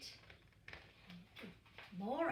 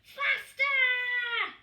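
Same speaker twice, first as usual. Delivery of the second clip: high, loud squeal of joy; little penguin loving the speed; thrilled chick; 13 semitones higher, 12 dB louder than usual. A narrator's reading. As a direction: shriek with delight on a fast ride. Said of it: "said Peaky".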